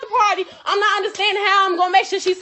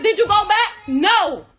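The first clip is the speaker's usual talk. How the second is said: normal talking voice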